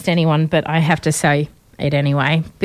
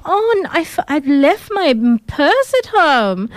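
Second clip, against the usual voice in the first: Anna; 8 semitones higher than usual. Acted. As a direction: put on a snooty Russian-German heiress accent, pitched way up. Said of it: "the accent is interesting".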